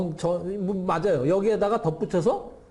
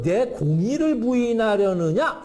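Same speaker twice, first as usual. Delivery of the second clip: high, slow, drawn-out rebuke as God; acted divine indignation, pitched up and drawn out; indignant challenge; 6 semitones higher, 3 dB louder, slower than usual. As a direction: stretch out a lofty, accusing question. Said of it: "이렇게".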